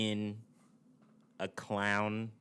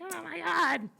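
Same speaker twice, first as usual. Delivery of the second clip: very high mocking squeal; exaggerated high-pitched complainer voice; mock the complainers in a squeaky put-on voice; annoying critic; slower than usual. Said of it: "They talk like that".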